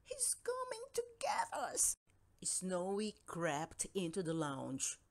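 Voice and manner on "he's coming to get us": high frightened whisper; scared whisper. Acted. Scared cat whispers fearfully to Stanley, high and hushed. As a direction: hush it out, terrified it's coming.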